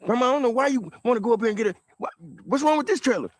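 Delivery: high, fast, whiny mock of the cousin; exaggerated impression of a flustered relative; mocking cousin's whine. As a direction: mimic him rushing and fussing at grandma. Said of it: "you hold your whole fucking demeanor".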